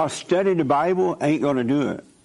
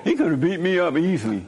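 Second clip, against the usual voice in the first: laughing; 7 semitones higher than usual. Real, not acted. Telling listeners about the guard, laughing through it. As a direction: chuckle it out, amused and amazed.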